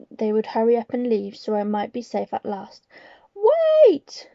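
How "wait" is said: high piercing shriek. Acted. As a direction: shriek it out in alarm. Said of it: "shrieked one of the witches".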